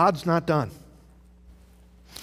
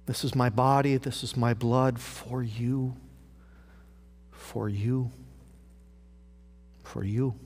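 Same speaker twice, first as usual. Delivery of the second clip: tender words of Jesus; 5 semitones lower, 5 dB quieter than usual. Acted. Quoting Jesus at the supper.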